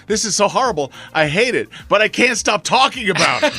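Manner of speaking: retold girlfriend. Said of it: "And she goes".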